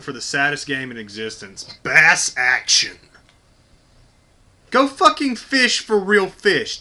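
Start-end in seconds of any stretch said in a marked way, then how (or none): 1.8-3.0 loud, drawn-out mock title announcement; mock announcer. Naming the saddest game in existence, mock announcer voice. Mocking a fishing game's title. 4.7-6.8 loud, high, slow exasperated jab; exasperated rant. Real, not acted. Gamer scorning fishing games, loud exasperated rant.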